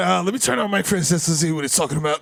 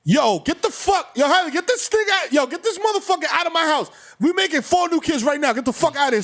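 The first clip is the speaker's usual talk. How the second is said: put-on higher, yelled tirade at the kid; mock angry yelling; 7 semitones higher than usual. Dad throwing the kid out over crisps, yelling.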